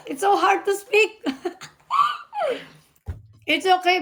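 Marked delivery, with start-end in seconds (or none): 0.1-1.2 higher, laughing through it. Real, not acted. Wife laughing at struggling with English. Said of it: "Nahirapan ako". 1.9-2.6 high-pitched laugh burst; high giggle. Real, not acted. Wife bursts into high laughter at herself.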